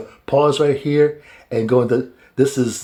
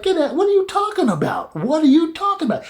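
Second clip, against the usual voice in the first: very high incredulous mock; disbelieving father. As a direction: refuse to believe it, pitched up. Jacob disbelieves.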